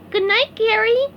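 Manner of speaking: SpongeBob voice. A narrator's reading. SpongeBob saying good night.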